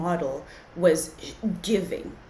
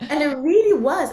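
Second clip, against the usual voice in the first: laughing agreement; 8 semitones higher, 8 dB louder than usual. Real, not acted.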